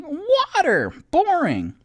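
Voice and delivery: high-pitched playful heckle; exaggerated high jeer; mock outburst. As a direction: whine it high and loud in mock protest. Mock outburst at mom's text.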